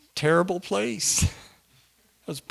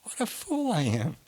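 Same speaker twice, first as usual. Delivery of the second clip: self-reproach; 6 dB quieter than usual. Retold or imitated. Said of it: "I was thinking".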